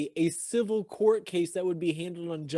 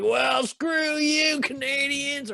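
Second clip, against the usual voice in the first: defiant sneer; 8 semitones higher, much slower than usual. Retold or imitated. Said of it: "he would be like".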